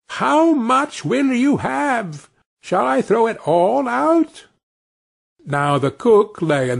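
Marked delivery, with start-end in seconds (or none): 0.1-4.5 much higher, slow tiny-voiced bawl; little Tom hollering on purpose to wake the house. Tiny Tom deliberately bawls to the thieves again, high and drawn out. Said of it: "bawled out again".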